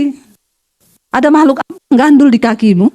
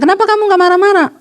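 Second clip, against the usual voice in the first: higher-pitched imitation of a questioning husband; puzzled husband; 6 semitones higher than usual. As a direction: play the husband, baffled and high. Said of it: "Satu kali suaminya pulang". (suami bertanya heran, suara meninggi)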